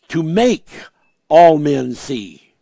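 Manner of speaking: slow, forceful, hitting ALL hard; forceful quote. Acted. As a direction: lean on all and drag it slowly. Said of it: "does that not show a little bit of forcefulness".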